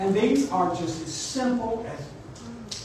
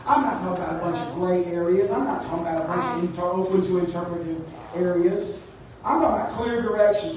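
ordinary talk, nothing marked

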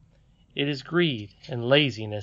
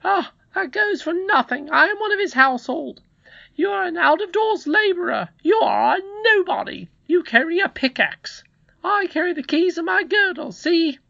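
very high, loud put-down; haughty housekeeper; 6 dB louder than usual. Acted. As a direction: lord it over the boy in a high voice.

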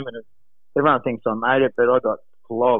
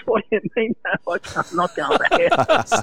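much higher, put-on voice of a panicked caddy; caddy's protest; 10 semitones higher than usual. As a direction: mimic the caddy refusing, voice up high. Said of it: "He goes".

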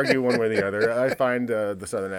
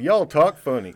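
louder, higher imitated southern accent; 5 semitones higher, 8 dB louder, slower than usual. Retelling an argument with a Missouri man, in a southern accent. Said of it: "a southern accent like you".

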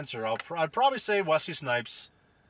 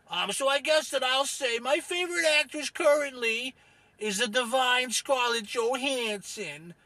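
gruff-tempered Rufus character; 7 semitones higher than usual. Acted. Rufus names his favorite actress to host, cranky character voice. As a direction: stretch word endings as an opinionated oddball.

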